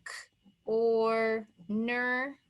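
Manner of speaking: "k", "or", "ner" in separate pieces; segmented phonics demo of corner; sounded out. Shown as a demonstration. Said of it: "Let's write it over here".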